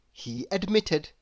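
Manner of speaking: ordinary delivery, nothing marked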